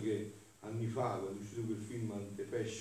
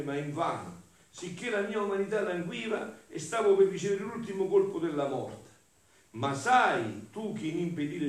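raised, emphatic declamation; impassioned devotional reading; 3 semitones higher, 6 dB louder than usual. Acted. Preacher reads Jesus' agony before the Father, impassioned declamation. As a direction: declaim the anguish to the congregation. Reads the same text with force.

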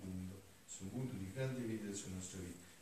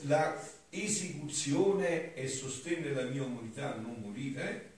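raised, loud declaiming voice; higher-pitched oratorical reading aloud; solemn devotional reading; 5 semitones higher, 10 dB louder than usual. Acted. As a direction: declaim the sacred text with fervour. Reads devotional text aloud.

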